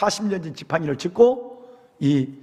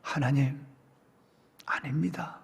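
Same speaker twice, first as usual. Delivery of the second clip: hushed reply; 3 semitones lower, 10 dB quieter than usual. A narrator's reading. Moses answers softly.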